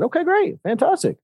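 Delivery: overly cheery acceptance, tongue in cheek; mock upbeat. Dad answers son again.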